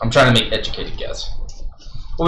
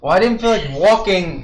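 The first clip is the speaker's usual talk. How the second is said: raised, carrying magical boom; 7 semitones higher than usual. Acted. Caster explaining himself to the bard, still booming. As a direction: keep projecting as if magically amplified. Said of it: "as I'm still booming".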